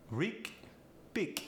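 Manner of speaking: "rig, big" ending in a hard K; Polish-accent G as K. Shown as a demonstration. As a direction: say "rig, big" as "reek, beek". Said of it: "The G goes to a K".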